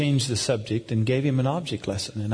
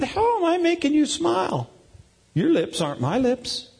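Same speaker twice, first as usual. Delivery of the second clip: high-pitched playful protest; mock indignation; 13 semitones higher than usual. Dad protesting to his son, high mock indignation. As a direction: argue innocence in a raised pitch.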